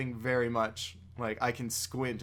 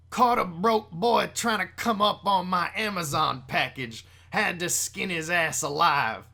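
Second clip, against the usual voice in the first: higher put-on character voice with drawn-out endings; 4 semitones higher, 4 dB louder than usual. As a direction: do a loud, swaggering character read. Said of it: "get into the Dracula Flow voice".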